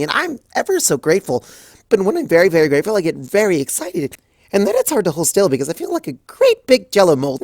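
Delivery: high, fast, breathless babble; Pinkie, bubbly and excited. Acted. Pinkie gushing to Rarity about her outfit, bubbly. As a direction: gush without stopping for air.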